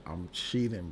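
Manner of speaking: normal talking voice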